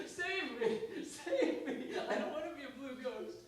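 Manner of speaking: high, pleading, fast wail; hammed-up ugly crying plea; snotty crying. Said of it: "Ugly crying".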